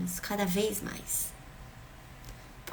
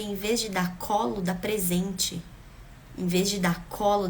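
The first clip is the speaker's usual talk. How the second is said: ordinary delivery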